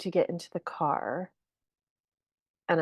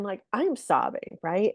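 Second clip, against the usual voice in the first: ordinary talk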